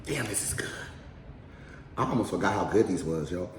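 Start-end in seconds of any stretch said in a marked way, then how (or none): 0.0-0.7 whisper. Real, not acted. Whispered while eating.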